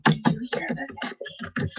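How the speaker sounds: breathy whispered ASMR-creator parody, very soft; mock the soft-spoken ASMR voice. Mocking ASMR creators to her viewers, whispering. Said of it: "they be like".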